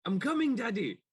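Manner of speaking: put-on entranced child. Entranced player answers phantom.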